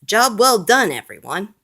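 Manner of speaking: loud, high, proud boss; proud fire chief. A narrator's reading. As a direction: cheer the team on proudly. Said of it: "The fire chief is proud of his team".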